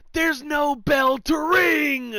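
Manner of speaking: slow, high raspy yell; Orton raspy shout. Retold or imitated. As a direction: bellow it slowly, imitating an angry wrestler. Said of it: "Orton's got, like, that little raspy voice".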